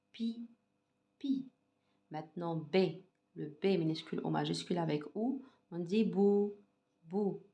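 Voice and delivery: put-on soft, slow syllable drill for children. Shown as a demonstration. Teacher drills syllables for young pupils, softly. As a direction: chant the syllables gently, one by one.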